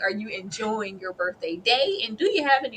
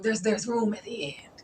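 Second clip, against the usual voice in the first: whisper; 4 dB quieter than usual. Real, not acted. Whispered aside in emotional recap.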